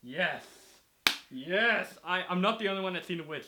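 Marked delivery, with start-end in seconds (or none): 1.3-3.2 loud, high burst of joy; real delighted shout; excited yes. Real, not acted. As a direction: cheer it out, delighted. Thrilled the movie is shared.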